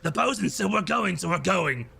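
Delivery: goblin backing up the boss; Slug. Acted. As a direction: argue like a bossy little goblin. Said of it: "Slug, one of the goblins".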